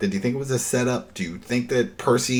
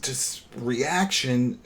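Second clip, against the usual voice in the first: ordinary talk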